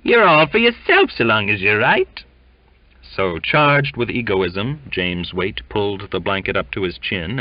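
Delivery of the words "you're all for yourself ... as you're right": high, loud disgusted sneer; loud disgusted Cockney; sudden disgust. Acted. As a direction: spit it out in contempt. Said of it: "with sudden disgust".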